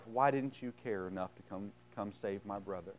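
soft, understated accusing plea; subdued grieving question; hurt reproach. Acted. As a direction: ask it quietly, as if let down. Voicing Mary's hurt reproach to Jesus.

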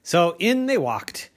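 ordinary delivery

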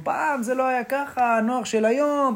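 a hint of a complaining old-timer; grumbling old man. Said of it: "תמיד יש את הזקנים האלה שאומרים". (זקן מתלונן בקול קל)

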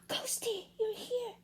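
put-on surprised little girl, high and hushed; high child voice. Bella surprised to hear Ghosty, high and soft.

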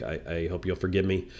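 ordinary talk

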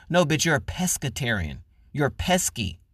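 usual speaking voice

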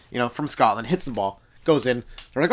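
normal talking voice

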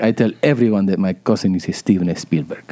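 low Peruvian-cousin impression; put-on Peruvian accent. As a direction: imitate a proud cousin from Peru.

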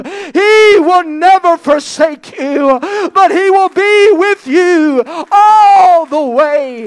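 loud, slow, crying out; climactic preaching. Real, not acted. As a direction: build the sermon to a shouted peak.

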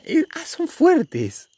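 high, very slow and stretched exclamation; stretched, scandalized ah; drawn-out shock. Real, not acted. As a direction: drag it out like you can't believe it. Reacting to shocking quiz questions.

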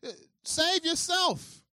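slow, high, loud sneer; mocking. Preacher voicing spectators taunting Jesus, loud sneer. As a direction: drag it out as a taunt. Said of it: "they're mocking him, saying".

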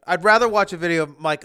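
ordinary talk

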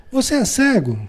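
high, scornful "Você é cego?"; rude retort. Retold or imitated. Reading out a young man snapping at a passerby, mock indignant. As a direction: hurl the question like an insult. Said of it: "reagiu, interrogando".

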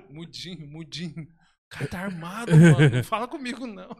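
quoting through giggles; barely holding the laugh in; laughing imitation. Imita o cara, rindo. (imitando rindo)

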